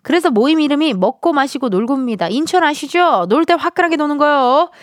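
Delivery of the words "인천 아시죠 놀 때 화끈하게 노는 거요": Incheon swagger. Retold or imitated. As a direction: sell it with Incheon bravado. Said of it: "마지막 멘트에서 인천 향이 확 느껴집니다".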